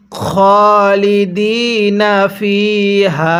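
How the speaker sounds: slow, drawn-out recitation of খালিদিনা with long vowels; Quran recitation. Shown as a demonstration. Teacher modelling খালিদিনা for viewers to repeat, slow recitation. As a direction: recite খালিদিনা slowly, stretching each vowel. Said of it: "আর একবার আমি revision দিব".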